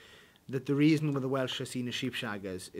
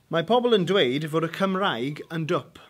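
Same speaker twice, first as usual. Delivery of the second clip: Welsh sentence; 4 semitones higher, 8 dB louder than usual. Shown as a demonstration. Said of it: "give you a new Welsh word".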